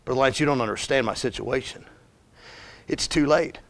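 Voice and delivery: low, soft, resigned objection; mild despondency. Acted. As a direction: voice a touch of hopelessness. Acted hopeless objection from a listener.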